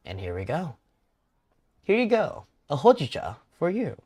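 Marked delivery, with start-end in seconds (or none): 1.8-4.0 higher, louder serving line; perky server voice. Acted. Barista serving a customer his hojicha, higher lively voice. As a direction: hand it over in a raised, lively voice.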